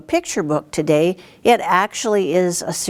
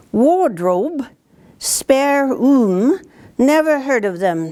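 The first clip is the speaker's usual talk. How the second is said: higher, questioning faun voice; puzzled faun; 7 semitones higher, slower than usual. Acted. Faun puzzled by Lucy's strange words, questioning and higher. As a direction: wonder aloud, baffled.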